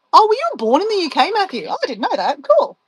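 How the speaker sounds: high, gushing mock surprise. Mimicking people who fuss over her Australian friend, high gushing. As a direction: parody a gushing fan, squeaking up on "Cool". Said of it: "some people would say".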